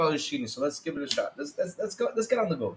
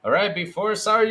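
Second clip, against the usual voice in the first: usual speaking voice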